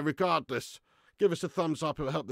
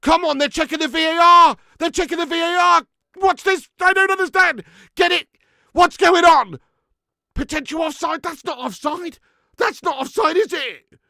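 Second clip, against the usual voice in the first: high, loud disbelieving protest; agitated shouting; 10 semitones higher, 11 dB louder than usual. Real, not acted. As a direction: shout in confusion at the referee's check. Agitated protest at VAR check.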